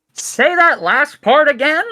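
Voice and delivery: high, loud demand from above; startled gate guard. Acted. Guard asking the prisoner to repeat it, high loud call. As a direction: call down in disbelief. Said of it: "and the guard says".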